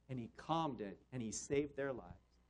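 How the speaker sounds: ordinary delivery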